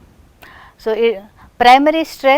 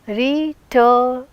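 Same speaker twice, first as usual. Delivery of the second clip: syllable by syllable; 2 semitones higher than usual. Shown as a demonstration. Teacher breaks word into syllables.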